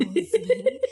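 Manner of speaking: Mosman said Mozman, fancy and high. Shown as a demonstration. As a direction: Mosman with a Z sound. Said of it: "a Z instead of an S".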